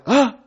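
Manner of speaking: short high gasp; startled gasp. Retold or imitated. Skydiver told no by instructor, startled high gasp. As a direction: gasp as if caught out.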